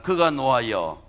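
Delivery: 회중이 따라 하도록 크게; loud call to repeat. Shown as a demonstration. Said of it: "여러분 한번 따라해서". (그가 노하여를 크고 힘차게)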